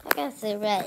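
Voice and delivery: normal talking voice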